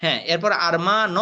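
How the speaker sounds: normal talking voice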